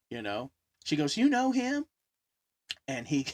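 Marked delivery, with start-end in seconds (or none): 1.2-1.9 high, louder incredulous question; retold surprise, pitched right up; acted surprised question. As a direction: imitate a startled girlfriend.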